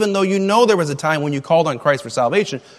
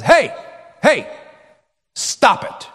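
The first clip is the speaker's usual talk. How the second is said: stern dad; 6 semitones higher, slower than usual. Retold or imitated. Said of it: "dad turns around and says".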